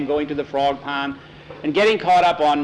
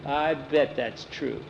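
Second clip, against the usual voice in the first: slow, softer doubting remark; dry scoffing; 6 dB quieter, slower than usual. A narrator's reading. Nancy doubts Skeeter's statue boast, dry scoffing. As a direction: draw it out softly, unconvinced.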